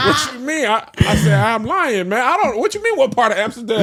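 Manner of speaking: high, loud, flustered stammer; high panicked voice. Retold or imitated. Caught lying about Amsterdam at breakfast, high panicked stammer. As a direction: squeak out the excuse in a panic. Mocking himself caught in lie.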